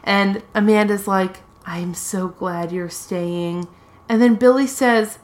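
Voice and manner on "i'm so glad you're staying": hushed, slow mock gratitude; quiet, drawn-out imitation of relief; soft and tender. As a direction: play a frail patient speaking very softly. Patient tenderly thanks her visitor.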